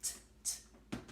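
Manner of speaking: "T, t" as two soft, unvoiced taps; only the consonant of "T", no vowel; isolated T sound. Shown as a demonstration. Teacher prompting children to hear the beginning, isolated T sound. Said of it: "what do you hear at the beginning".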